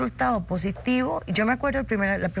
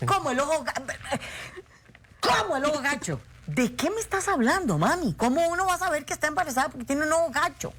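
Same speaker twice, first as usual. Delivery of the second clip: incredulous; 6 semitones higher than usual. Retold or imitated. Disbelieving reply to mother.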